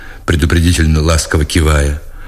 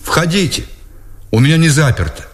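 higher, tentative call to come in; unsure reply; 8 semitones higher than usual. A narrator's reading. Sleepy officer answers the knock at his door, unsure. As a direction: answer without confidence, pitched up. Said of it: "откликнулся без уверенности".

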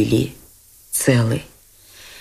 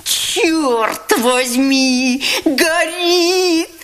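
high, drawn-out alarmed cry; panicked shout as the money burns; 12 semitones higher, much slower than usual. A narrator's reading. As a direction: yell it out in sudden panic. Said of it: "вскричал он".

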